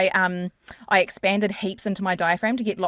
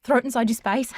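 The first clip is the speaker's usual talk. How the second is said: ordinary delivery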